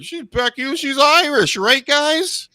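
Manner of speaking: high, loud mock hype voice pumping up the crowd; a hammed-up announcer selling the Irish star; mocking ring announcer. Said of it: "'cause it's like".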